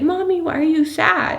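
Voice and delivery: put-on high, sweet child asking; four-year-old asking mommy why she's sad; little girl voice. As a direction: play her small daughter, concerned. Voicing her young daughter.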